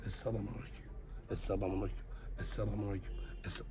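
murmured salam, almost whispered; quiet prayer greeting. Shown as a demonstration. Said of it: "Bir de yapar mısın?".

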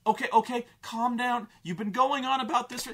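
mock dad calming her down; placating dad. Dad brushing off his daughter, mock soothing voice. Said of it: "the dad is like".

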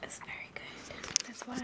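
soft whisper. Real, not acted. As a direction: whisper it quietly. Whispering, baby nearby.

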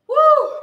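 high cheering whoop; excited woo. Real, not acted. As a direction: whoop to pump up the class. Coach cheering.

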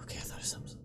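hushed breathy whisper. Real, not acted. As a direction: whisper it secretly. Sudden hushed aside while playing.

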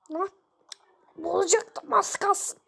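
ordinary talk, nothing marked

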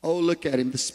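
sneering mock of the special one; jealous, scoffing sarcasm; mocking the favorite. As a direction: roll your eyes at the golden boy. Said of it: "Ain't that how they act?".

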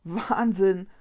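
usual speaking voice

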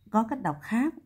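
usual speaking voice

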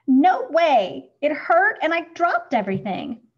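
loud, high, hurt retort; upset child snapping back. A narrator's reading. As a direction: bite it off, stung. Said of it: "Schubert snaps".

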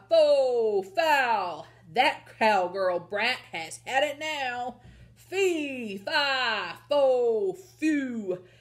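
loud, furious giant chant; enraged storybook giant on the chase; thundering giant. Acted. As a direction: bellow each syllable long, letting it fall. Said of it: "the giant's voice thundered".

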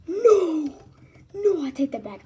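very loud, high cry of regret; panicked shout. Real, not acted. Taking back a move right after the countdown, loud regretful shout. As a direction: yell it out, then drop in pitch.

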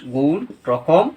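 গুণ, রকম said slowly and deliberately, word by word; teacher repeating the list at half speed; slow dictation. Shown as a demonstration. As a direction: spell out গুণ, রকম for students to copy. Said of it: "কে বলেছি আমি?".

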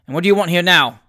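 loud, hostile demand; gruff, unwelcoming question; confrontational. A narrator's reading. Father confronts unwelcome son.

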